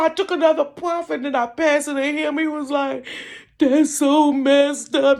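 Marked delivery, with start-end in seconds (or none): 3.6-5.2 slow, high, loud mock lament; mock crying. Friend consoling her over mama, starting to cry. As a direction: drag it out in tearful sympathy. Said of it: "He start crying".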